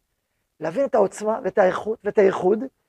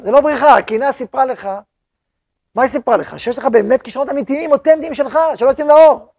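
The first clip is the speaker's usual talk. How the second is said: rising fervour; 3 semitones higher, 7 dB louder than usual. Acted.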